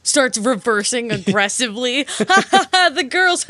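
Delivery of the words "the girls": giggling through the words; laughing. Real, not acted. Laughing at the film's line.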